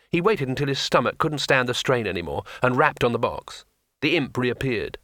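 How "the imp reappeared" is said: ordinary delivery, nothing marked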